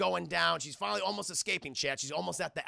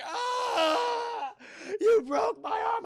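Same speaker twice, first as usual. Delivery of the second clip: very high, loud wail of pain; hammed-up shriek, imitating agony; mock agonised scream; 5 dB louder, much slower than usual. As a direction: scream it like an injured movie victim. Acting doctor whose arm is broken.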